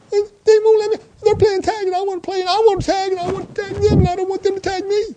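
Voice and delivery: put-on very high, loud little-kid whine; upset three-year-old. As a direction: imitate a small child complaining about being left out. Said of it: "if you could hear his voice".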